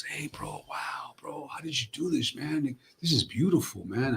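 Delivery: hushed admiring whisper from an extra; whispered quote. Retold or imitated. Background actor whispering to the star in awe. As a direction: whisper it, impressed and secret. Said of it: "he goes".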